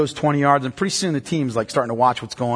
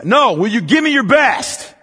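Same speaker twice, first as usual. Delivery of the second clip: higher, slow, forceful demand; demanding coach; 8 semitones higher, 3 dB louder, slower than usual. Retold or imitated. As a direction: press hard for a real commitment. Coach demands full commitment.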